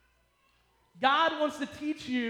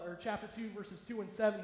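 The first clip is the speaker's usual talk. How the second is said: ordinary talk, nothing marked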